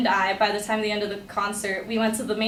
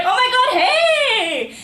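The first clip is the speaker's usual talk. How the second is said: very high, loud, drawn-out greeting; hammed-up excited shriek of hello; imitated delighted squeal; 13 semitones higher, 5 dB louder, much slower than usual. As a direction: squeal back like a thrilled best friend. Acted excited greeting back.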